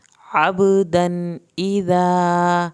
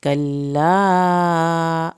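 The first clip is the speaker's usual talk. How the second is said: extremely slow, louder chant; slow recitation; 6 dB louder, much slower than usual. Shown as a demonstration. Teacher to students: slow loud tajweed chant. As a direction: stretch every long vowel.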